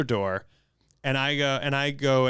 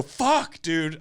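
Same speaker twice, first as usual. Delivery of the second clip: startled; 3 semitones higher than usual. Retold or imitated. Man startled by a door opening aggressively, startled. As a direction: blurt it out as if jumped. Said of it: "I was so startled".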